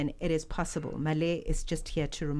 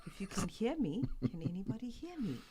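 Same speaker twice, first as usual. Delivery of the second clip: small, soft voice with a little laugh; barely-there voice, checking who can hear; mouse-like; 3 semitones higher, 7 dB quieter than usual. Real, not acted. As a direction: sound faint, like a mouse on the mic. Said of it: "I sound like a mouse".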